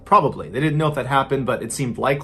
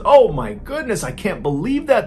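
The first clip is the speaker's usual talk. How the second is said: hammed-up fake horror, high and loud; mock shock; 8 semitones higher, 6 dB louder than usual. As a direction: overplay the shock like a bad liar. Killer feigns shock to police.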